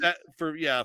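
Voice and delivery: ordinary talk, nothing marked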